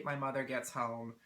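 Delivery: ordinary talk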